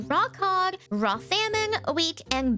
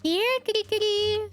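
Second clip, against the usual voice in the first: ordinary talk, nothing marked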